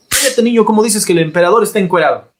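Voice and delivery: mock parent silencing the kid; a parent snaps at the child; scolding parent.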